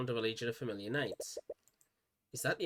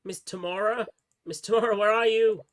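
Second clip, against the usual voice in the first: calling out for her; 7 semitones higher, 5 dB louder than usual. Acted. Searching the halls, calling out.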